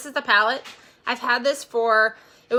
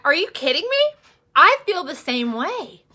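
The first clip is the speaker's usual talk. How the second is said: excited disbelief; 5 semitones higher, 6 dB louder than usual. Retold or imitated. Excited reply to a friend's message.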